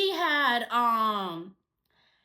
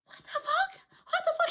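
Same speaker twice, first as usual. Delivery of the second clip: much higher, stunned "what the fuck"; shocked disbelief; 13 semitones higher, faster than usual. Retold or imitated. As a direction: gasp it out in disbelief. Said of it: "I'm like".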